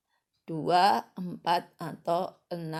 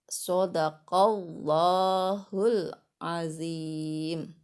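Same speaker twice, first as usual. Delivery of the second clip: slow chanted closing prayer; measured, sustained devotional chant; prayer recitation; 3 dB louder, much slower than usual. Real, not acted. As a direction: intone with long drawn-out syllables.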